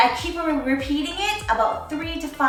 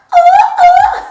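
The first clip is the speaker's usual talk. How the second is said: excited; 12 dB louder than usual. Real, not acted. Excited exclamation, very high loud.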